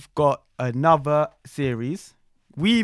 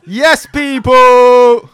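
stretched, high hype shout; 10 semitones higher, 16 dB louder, much slower than usual. Acted. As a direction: open the show like a hype host. Hype host opening the show.

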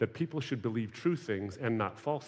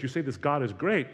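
ordinary delivery

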